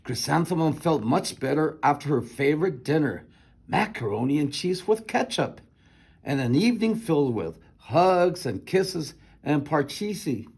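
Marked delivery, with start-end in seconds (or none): none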